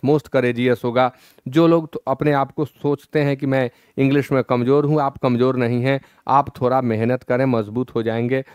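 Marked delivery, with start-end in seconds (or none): none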